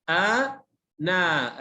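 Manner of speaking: أنا broken into long, separate syllables; stretched, teacherly spelling-out of أنا; spelled out. Shown as a demonstration. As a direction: sound out أنا very slowly, syllable by syllable. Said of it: "تهجأ".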